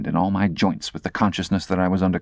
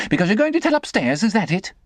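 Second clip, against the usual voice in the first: put-on angry girl; 13 semitones higher than usual. Estella sneering.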